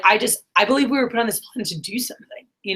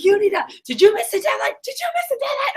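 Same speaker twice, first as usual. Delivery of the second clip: pitched way up, mock panicked mom; imitating nagging mom. Mom grilling her kid about a missed deadline, high mock nagging voice. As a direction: squeak it out like a frantic mom.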